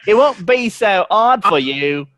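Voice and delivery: hammed-up slow Cockney accent; Cockney accent demo. Shown as a demonstration. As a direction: show him how Cockney goes, drawn out. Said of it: "try doing a Cockney accent".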